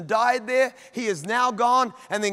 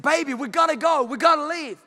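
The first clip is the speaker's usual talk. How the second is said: higher, quick, acted husband's urging; urgent pleading; 4 semitones higher than usual. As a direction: play a husband hurrying his wife along. Abraham urges Sarah to leave.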